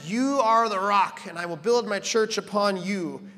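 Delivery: slow, loud, stress on are; emphatic quote. Retold or imitated. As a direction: proclaim it slowly. Quoting God, emphatic.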